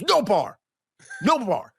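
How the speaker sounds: acted-out stern order, pitch jumping up; Tracy Morgan. Comic retelling of a star banning drinks, mock-insistent. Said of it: "Dude, Tracy Moore".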